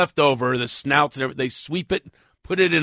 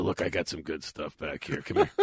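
soft, low back-alley sales pitch; hushed dealer offering secret goods; furtive dealer; 9 semitones lower, 9 dB quieter than usual. Acted. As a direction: quietly tout it like a shady dealer. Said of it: "I was like a dealer".

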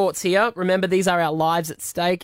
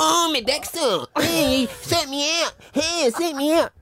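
higher, hammed-up Chinese accent; mock Chinese accent pitched up; imitated Chinese accent; 10 semitones higher than usual. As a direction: camp up a Chinese accent, slower. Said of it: "does my voice".

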